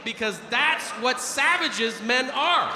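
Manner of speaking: high, slow, drawn-out emphasis; comic to the audience, slow emphatic punchline. Acted. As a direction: lean hard on every word.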